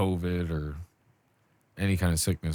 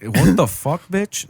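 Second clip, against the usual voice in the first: higher, louder hammed-up disbelief; loud mock outrage; 9 semitones higher, 8 dB louder, slower than usual. Echoing his friend's shock, loud mock outrage. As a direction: throw it out as fake outrage.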